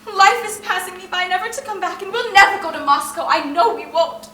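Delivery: loud, despairing wail; anguished cry. Acted. Irina despairing to her sisters, loud anguished cry. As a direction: cry out that all is lost.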